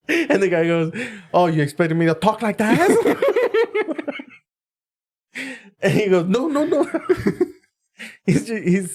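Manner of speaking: high-pitched retelling through real laughter; helpless laughter breaking into the quoted lines; cracking up. Real, not acted. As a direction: barely get the punchline out for laughing.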